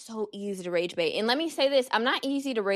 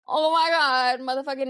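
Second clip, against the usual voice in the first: mock fright; 4 semitones higher, 5 dB louder than usual. Imagines a monster in lake.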